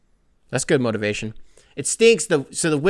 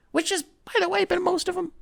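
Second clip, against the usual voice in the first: silly high aside; 5 dB quieter than usual. Real, not acted.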